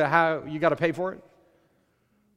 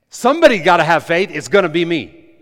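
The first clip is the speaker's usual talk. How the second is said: louder, firm and certain; bold declaration; 2 semitones higher, 9 dB louder than usual. Shown as a demonstration. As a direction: claim it, no hesitation. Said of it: "I don't even like the way that sounds".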